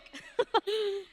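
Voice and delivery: high, light laughter; short high giggle, softening. Acted. Narrator amused by Puerto Rican speech, giggling high. Laughs after the singsong line.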